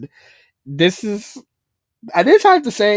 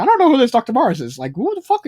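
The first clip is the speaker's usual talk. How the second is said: quick, higher, baffled denial; confused protest; 8 semitones higher, faster than usual. Retold or imitated. Mario jailed and accused, quick bewildered denial. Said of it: "completely confused about the whole thing".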